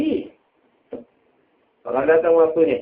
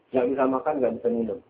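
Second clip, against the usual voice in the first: usual speaking voice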